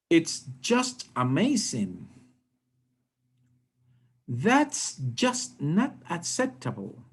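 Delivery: slow, every "just" leaned on with intonation; emphatic "just". Shown as a demonstration. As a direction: stress "just" to make each example emphatic. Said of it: "in all those cases, we also emphasize, uh, with the, uh, intonation".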